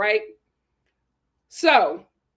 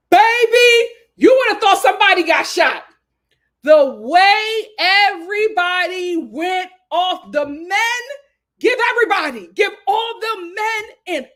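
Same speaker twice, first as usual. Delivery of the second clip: higher and louder, gossip in full swing; excited storytelling; 5 semitones higher, 6 dB louder than usual. Real, not acted. Telling friends the chaos with rising excitement. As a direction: whip up the drama. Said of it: "recounting the chaos".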